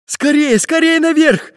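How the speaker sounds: high-pitched urgent call; urgent cry. A narrator's reading. Pencil calling the pirates up the tree, crying out. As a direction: cry out to hurry them up. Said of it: "крикнул пиратам Карандаш".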